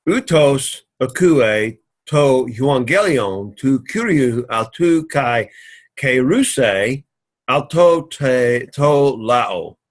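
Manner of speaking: each Greek word read slowly and carefully. Shown as a demonstration. Teacher reading the Greek verse to students, slow and careful.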